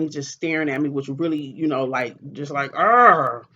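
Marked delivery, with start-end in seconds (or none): 2.7-3.5 loud sudden mock roar; angry growl. Glaring at him in the chair, loud angry growl. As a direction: let out a short loud growl of rage.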